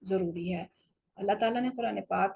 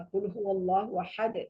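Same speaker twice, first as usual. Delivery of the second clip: chanted verse, then halt; recitation with a stop. Shown as a demonstration. Teacher recites the first verse to show the stop. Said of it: "یہ شروع کی اور stop کیا".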